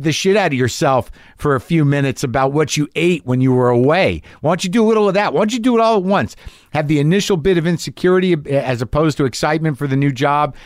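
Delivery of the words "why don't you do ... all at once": high, taunting self-sabotage; sneering inner critic, fast at the end; mocking inner voice. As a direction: goad yourself sarcastically.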